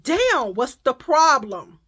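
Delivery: loud, drawn-out indignant question; retold mad challenge. Shopper confronting a woman in the aisle, retold mad. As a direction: demand it loudly, acting out being fed up. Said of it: "'cause now I'm mad".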